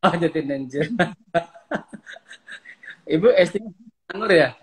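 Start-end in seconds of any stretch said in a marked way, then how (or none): 0.9-3.0 hearty chuckle; laughing. Real, not acted. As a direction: friendly burst of laughter. Host reacts to guest's answer.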